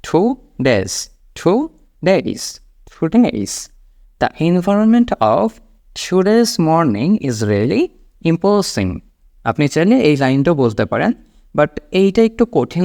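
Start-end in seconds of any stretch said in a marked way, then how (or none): 0.0-3.7 "Today's" slowly in two parts, airy T first; aspirated T. Shown as a demonstration. Teacher drills learners, "টু ডেইজ" with aspirated T. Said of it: "ঠ দিয়ে উচ্চারণ". 4.2-9.0 very slow, careful English phrases; slow model sentence. Shown as a demonstration. Teacher repeats the corrected line for learners, very slowly. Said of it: "তাহলে কেমন হবে এটা".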